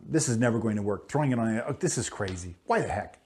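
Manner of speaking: soft, grumbling doubt under the breath; Peter grumbling to himself. Retold or imitated. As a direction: mutter it like a sceptical fisherman. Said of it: "is what Peter might have thought".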